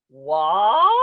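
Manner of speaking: loud, stretched rising "What?"; friend quoting a catchphrase. Retold or imitated. Friend quoting a character's line at the actor, exaggerated questioning "What?". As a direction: draw out "What?" and swoop upward. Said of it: "he literally will do".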